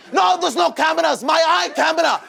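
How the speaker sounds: owner shouting. Retold or imitated. Owner still yelling.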